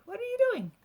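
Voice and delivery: ordinary delivery, nothing marked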